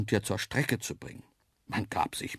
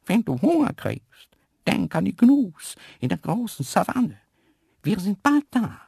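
Isabella whispering; 10 semitones higher, 6 dB louder than usual. A narrator's reading. Antelope Isabella telling the lion in the crate to sit still, high and coaxing. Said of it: "wisperte Isabella".

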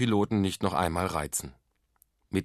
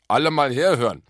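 loud, raised call for attention; lauter Aufruf; 6 semitones higher, 9 dB louder than usual. A narrator's reading. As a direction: call across a noisy party. Uncle calls the party to attention. (laut und hoch in die Runde gerufen)

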